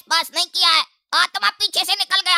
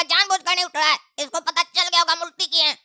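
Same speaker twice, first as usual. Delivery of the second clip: ordinary talk, nothing marked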